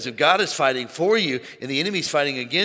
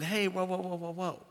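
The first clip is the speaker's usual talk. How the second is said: soft, hushed whoa whoa; hushed, soothing restraint; gentle inner voice; 2 semitones higher, 11 dB quieter than usual. Retold or imitated. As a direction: ease someone down gently, very softly. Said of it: "He's always saying".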